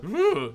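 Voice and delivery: loud, high groan of distaste; mock groan of distaste. Britain reacting to the EU, with a loud groan of distaste. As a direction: groan as if something smells off. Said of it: "we sort of approach the E U thing kind of like".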